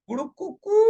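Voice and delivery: playful rooster imitation; rooster crow. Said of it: "जैसे मुर्गा कभी बोलता है ना".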